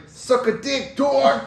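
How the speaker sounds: high, loud New York shout; New York accent. Retold or imitated. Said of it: "I had, like, a New York accent".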